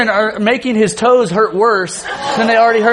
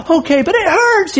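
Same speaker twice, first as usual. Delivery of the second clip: put-on high-pitched whiny kid complaint; whining child; 11 semitones higher than usual. Kids complaining to dad about scraped toes, whining. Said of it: "they're whining and just complaining".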